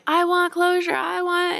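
high pleading inner voice; voicing the brain. Brain voice begging for closure. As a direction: whine it like a needy brain. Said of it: "So the brain may be going".

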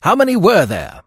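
high, loud, eager question; quick demand. A narrator's reading. Detective presses prisoner for how many, quickly. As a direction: press urgently for the number. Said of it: "said the detective quickly".

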